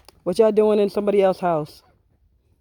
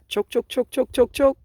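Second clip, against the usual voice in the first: high clucking hen voice, pitched up; imitating chicken clucks; 6 semitones higher than usual. Owner copying her hens' chatter in a high cluck. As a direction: mimic the hens chattering back at them.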